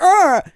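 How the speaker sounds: sudden loud high yelp of pain. Retold or imitated. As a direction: yelp as if whipped in the face. Monk hit by whip.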